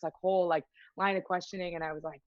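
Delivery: ordinary talk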